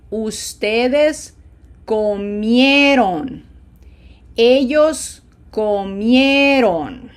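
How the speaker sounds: very slow drill, MI and MIE in "comí", "comieron" stretched and pushed; slow repeat-after-me. Shown as a demonstration. Teacher leading learners to repeat, slow with emphatic stressed syllables. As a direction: go word by word with oomph on the stressed syllable. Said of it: "Repeat after me slowly".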